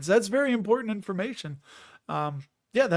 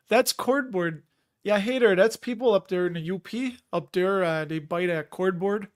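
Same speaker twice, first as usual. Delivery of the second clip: put-on Yooper accent; 2 semitones higher than usual. Streamer imitating people up in the U P, rough Yooper accent. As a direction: put on an imperfect Upper Peninsula accent. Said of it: "I gotta work on that accent".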